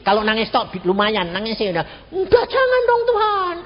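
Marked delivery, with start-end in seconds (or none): 2.1-3.6 high, wailing mock sob, louder; hammed-up weeping prayer, much higher; mock crying plea. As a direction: imitate relatives begging God through tears. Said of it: "Menangisnya kayak gini".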